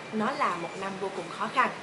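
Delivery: ordinary talk, nothing marked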